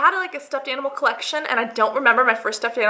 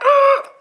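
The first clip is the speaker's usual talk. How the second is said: high, loud strained squeal; stressed ugh; 7 dB louder than usual. Retold or imitated. Acting out stressed pimple-picking for viewers, strained groan. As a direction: let out a frustrated squeeze noise.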